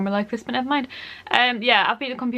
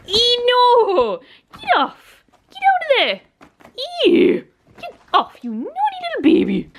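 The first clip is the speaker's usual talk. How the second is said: playful scolding; 14 semitones higher, slower than usual. Real, not acted. Scolding a naughty little one.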